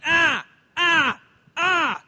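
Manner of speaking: short, loud, high "ah" bursts; imitated baby yell. Baby in high chair before feeding time, imitated yelling. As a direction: yelp like a hungry baby. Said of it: "she'll just yell and scream".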